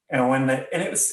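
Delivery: usual speaking voice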